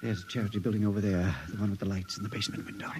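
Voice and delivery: hushed whisper. Acted. Patient whispers to his friend while sneaking at night, hushed. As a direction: whisper so no one hears.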